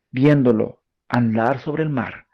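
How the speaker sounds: usual speaking voice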